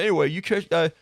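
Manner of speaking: put-on Mancunian accent. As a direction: chat about footy like a Manchester lad. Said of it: "voice I do for Pin Dad and Puppet Master".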